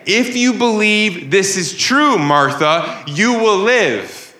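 bold preaching proclamation, key words drawn out; Jesus boldly promising life; bold, rightful declaration. Acted. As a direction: declare it slowly, pitched high, with authority. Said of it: "he can boldly and radically and rightfully say".

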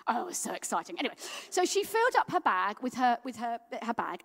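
ordinary delivery

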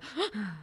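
imitated sobbing child's gasp; mimicked hitching gasp; hyperventilating gasp. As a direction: catch the breath sharply like a crying child. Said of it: "the hyperventilating, you know, they're trying to talk".

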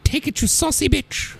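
high, lightly posh Queen. Retold or imitated. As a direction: do a cheeky old Queen. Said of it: "the Queen being like".

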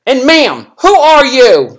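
loud, high, slow demand; stern judge. Retold or imitated. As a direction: demand an answer, judge style. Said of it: "she'd be like".

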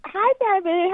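normal talking voice

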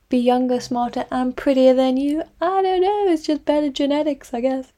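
high, smug teasing lilt. A narrator's reading. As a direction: tease her, sing-song. Julia teasing sister.

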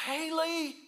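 high, loud call of a name; father jumping to the phone, calling her name high. Retold or imitated. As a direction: call out for the lost girl. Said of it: "I would say".

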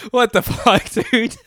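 high-pitched incredulous cry; laughing disbelief. Retold or imitated. Storyteller voicing his friend's shock at the sight, laughing disbelief. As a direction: retell it high, on the edge of laughter.

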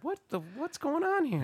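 very high-pitched imitation of a baffled wife; bewildered wife. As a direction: squeak it in mock bewilderment. Retold freezing wife's bewildered reaction.

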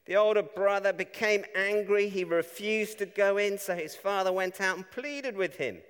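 proclaiming the Gospel text from the pulpit; scripture reading. Acted. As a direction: read the passage out with force. Reads scripture aloud.

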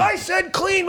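very high, strangled furious owner; mock explosive anger. Club owner to comic after the show, high furious squeak. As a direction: imitate a boss about to explode. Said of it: "like about to explode".

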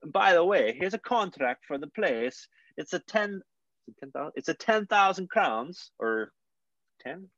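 put-on high-pitched Swedish landlord accent; slow, halting sales pitch in a Swedish accent. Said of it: "I'm gonna do my Swedish accent".

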